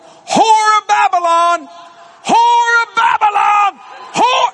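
high, slow, full-force accusing shout; hammed-up prophet's cry, each word stretched loud; shouted denunciation. Man points at the woman before the whole plane, shouting denunciation.

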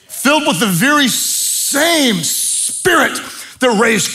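loud, high, impassioned sermon build; fiery preaching. Acted. Pastor rallying the men of the church, fiery preaching.

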